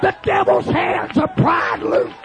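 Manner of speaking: impassioned, shouted revival sermon; full-force preaching. Acted. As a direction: preach it hard to a shouting congregation.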